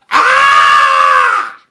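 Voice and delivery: long, very loud "Ah!" scream; exasperated scream. Acted. Mother at her wits' end with Jack, long scream. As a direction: scream out all the frustration.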